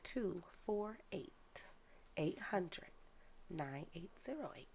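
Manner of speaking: careful dictation in small groups; real slow. Real, not acted. Giving viewers her number to write down, real slow. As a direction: pause after each group of digits. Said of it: "I want you to say it real slow".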